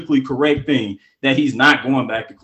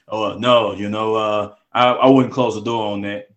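LeBron impression; 7 semitones lower than usual. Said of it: "LeBron's answer quoted".

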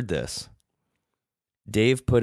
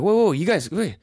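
startled; 11 semitones higher, 5 dB louder than usual. Retold or imitated. Alarmed, calling to the girls.

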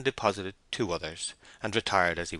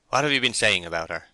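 louder, heated question; sharp inquiry; 2 semitones higher, 7 dB louder, faster than usual. A narrator's reading. Shepherd sharply questioning the men about the missus, getting heated. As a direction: demand it sharply, getting warm. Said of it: "sharply, turning to the rest, and getting very warm".

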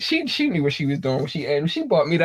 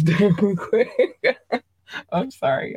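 usual speaking voice

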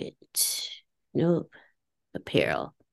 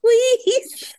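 put-on high squeaky childlike please; little girl voice; 5 dB louder than usual. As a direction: beg in a tiny girl voice. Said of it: "little girl voice".

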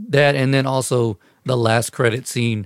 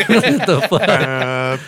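slow laughing disbelief; 5 semitones higher, 5 dB louder, much slower than usual. Real, not acted. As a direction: laugh it out slowly. Laughing at music interruption.

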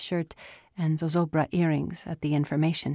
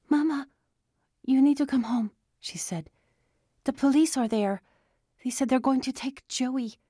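urgent, frightened; 8 semitones higher than usual. Acted. Urgent news, police at home.

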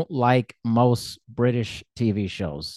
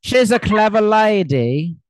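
loud, high, slow fake British; bad British accent; 7 semitones higher, 5 dB louder, slower than usual. Shown as a demonstration. American host performing on request, hammed-up British accent. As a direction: overdo a poor British accent. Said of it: "Do your best British accent".

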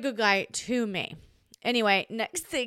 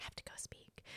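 hushed, unvoiced whisper; 25 dB quieter, faster than usual. Slipping away from the couple in a hushed whisper. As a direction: breathe it out, barely voiced. Retelling excuse to leave.

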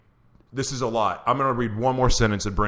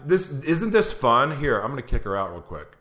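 usual speaking voice

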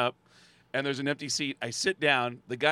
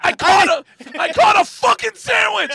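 very high, loud triumphant shout; hammed-up ecstatic yelling; excited crowd member; 16 dB louder than usual. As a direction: scream it like you won a prize. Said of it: "With people going".

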